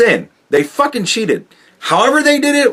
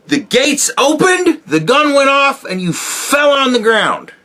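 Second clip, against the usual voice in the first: mock race commentary; 11 semitones higher than usual. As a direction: belt it out like a sportscaster watching a runner trip. Mocking Biden losing the race.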